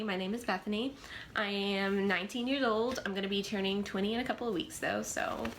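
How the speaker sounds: normal talking voice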